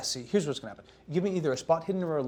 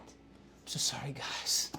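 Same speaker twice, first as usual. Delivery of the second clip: whisper; 7 dB quieter, slower than usual. Real, not acted. Apologetic aside to players.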